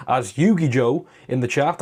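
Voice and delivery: ordinary delivery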